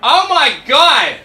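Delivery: high, loud, drawn-out American gushing; mock American accent. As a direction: exaggerate an amazed American woman. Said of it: "where an American ... she was like".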